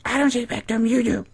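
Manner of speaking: high falsetto mock-mother retort; Daisy. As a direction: imitate a defensive mom in falsetto. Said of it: "And she's like".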